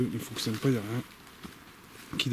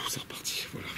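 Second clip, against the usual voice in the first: breathless whisper under the breath; 8 dB quieter than usual. Real, not acted. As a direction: whisper it to yourself. Sighing aside in dark woods. (chuchoté)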